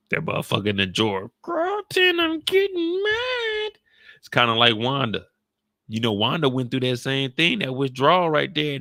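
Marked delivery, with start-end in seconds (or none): 1.4-3.7 very high falsetto, slow and shouted; drugs getting mad. Acted. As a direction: threaten in a high squeaky shout.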